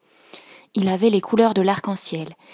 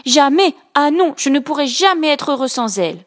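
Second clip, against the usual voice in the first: indignant; 5 semitones higher, 4 dB louder than usual. Acted. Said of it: "pensa-t-il indigné".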